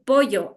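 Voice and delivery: "pollo" said loud with a coastal Y sound; coastal pronunciation. Shown as a demonstration. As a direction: demonstrate "pollo" as poyo, punched out. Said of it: "algunos dicen así".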